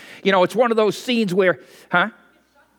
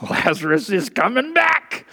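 excited; 2 semitones higher than usual. Retold or imitated. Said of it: "Jesus isn't kind of excited".

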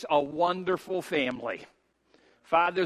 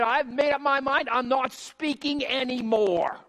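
fed-up resolve; 6 semitones higher than usual. Retold or imitated. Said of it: "Jeremiah said".

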